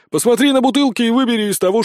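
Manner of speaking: fast, high, loud scolding; indignant. A narrator's reading. Companion scolds the sergeant over the bottles, indignant. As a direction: rush out an exasperated order. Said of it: "возмутился Спирт".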